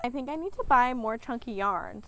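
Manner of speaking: usual speaking voice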